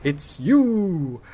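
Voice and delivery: high, swooping announcer with a long "you"; booming slogan voice. Retold or imitated. Commentator echoes the slogan in his head, booming announcer voice. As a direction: stretch "you" like a lottery advert. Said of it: "a booming voice would go".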